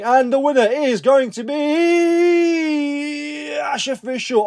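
high, drawn-out game show reveal; slow announcer drawl to the name; winner announcement. Pretend.

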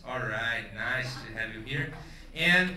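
ordinary talk

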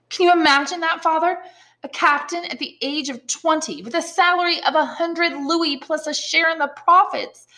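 high, eager character voices; voiced characters. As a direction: read the reunion dialogue with lively character voices. Reads dialogue in raised voice.

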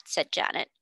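ordinary delivery

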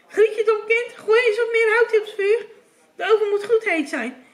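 high, loud commanding witch; scolding witch. A narrator's reading. Witch demanding wood for the oven, scolding. Witch barks orders at Grietje.

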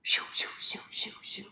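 breathy shoo; whispered shooing. Acted. The dog shoos the snake off, whispering. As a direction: hiss it away.

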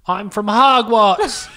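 loud, high, slow mock British accent; Harry Potter. Shop assistant boasting of home, hammed-up British accent. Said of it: "talk like Harry Potter".

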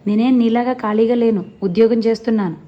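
irritated snapping. Acted. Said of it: "సత్తిపండుకు తిక్క రేగింది".

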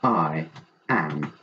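low, deliberate closing line; slow confession. A narrator's reading. Story's last line as a confession, low and slow. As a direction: own up slowly, word by word.

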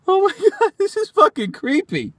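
high-pitched creeped-out reaction. Acted. Reading a stalker's letter, creeped out. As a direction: recoil in a high, uneasy voice.

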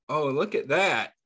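normal talking voice

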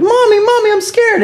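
put-on high, loud whimpering kid; hammed-up terrified child calling for mommy; scared child voice. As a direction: cry out like a frightened little kid.

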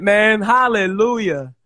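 ordinary delivery

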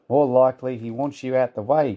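plain reading voice

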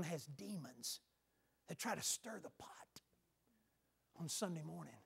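soft, hushed warning; whispering. Real, not acted. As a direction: lean in and whisper it. Hushed warning about demons.

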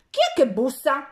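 mildly gruff challenge from behind the rock; hoarse, unpleasant voice. A narrator's reading. Devil behind the rock answering the knock, lightly hoarse. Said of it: "una voce roca e sgradevole".

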